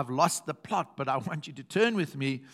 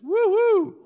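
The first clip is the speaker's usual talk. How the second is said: high, loud whoop of joy; exultant preacher's woo; excited cry; 8 dB louder than usual. Real, not acted. Preacher's exultant cry over scripture.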